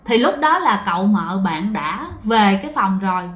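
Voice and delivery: plain reading voice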